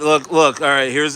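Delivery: normal talking voice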